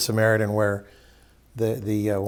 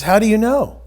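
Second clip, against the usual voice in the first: astonished question; 8 semitones higher, 4 dB louder than usual. Retold or imitated. Thief stunned the man survived.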